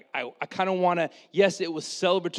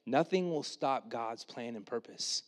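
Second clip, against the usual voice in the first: calm Jesus; 4 semitones lower, 10 dB quieter than usual. Retold or imitated. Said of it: "he's like".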